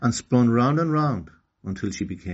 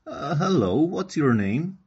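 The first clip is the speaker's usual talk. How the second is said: voicing Okani; 7 semitones higher, 4 dB louder, slower than usual. Okani meets a fish.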